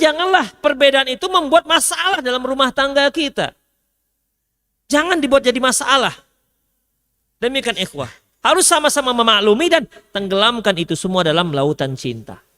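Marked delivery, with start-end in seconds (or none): none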